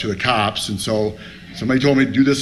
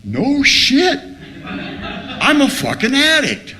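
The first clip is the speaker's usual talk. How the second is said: stunned realization; 11 semitones higher, much slower than usual. Retold or imitated. Addict acting out reading the first step, stunned realization. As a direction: cry it out high as it dawns on you.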